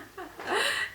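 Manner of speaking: high, soft rising giggle. Real, not acted. Mother giggling at her drugged memory. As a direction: let a little giggle slip. Amused laugh.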